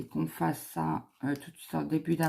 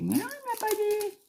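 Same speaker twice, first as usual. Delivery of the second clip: much higher, mocking put-on voice of the husband. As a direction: parody the husband denying it in a silly high voice. (imitation moqueuse du mari, voix beaucoup plus aiguë)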